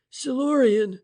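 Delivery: loud, high shout of victory; triumphant cry. A narrator's reading. French striking the table, crying out in triumph. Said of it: "French cried".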